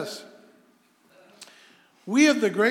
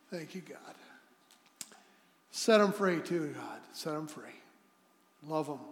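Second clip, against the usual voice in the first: spoken prayer; 4 semitones lower than usual. Real, not acted. Leads a prayer.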